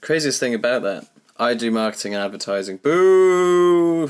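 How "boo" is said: mock boo. Mock booing own job.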